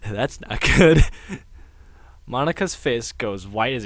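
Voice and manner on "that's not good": louder, slow "That's not good." with a laugh; nervous laugh. Acted.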